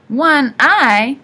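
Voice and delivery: loud, high, incredulous question; disbelief. A narrator's reading. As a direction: blurt it out in disbelief. Boy reacts in disbelief to news.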